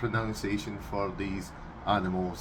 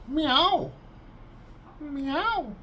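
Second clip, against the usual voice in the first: high squeaky cat mew; cat meow impression; 14 semitones higher than usual. Shown as a demonstration. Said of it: "for us".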